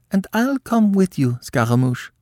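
high, impulsive announcement; eager cry. A narrator's reading. Columbine jumping up to follow Scaramouche, eager cry. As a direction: pipe up high to join him. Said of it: "cried she".

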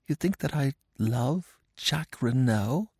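high, soft, halting question; small, soft woman's voice, rising; whispered. A narrator's reading. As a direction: ask it in hushed disbelief. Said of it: "she whispered".

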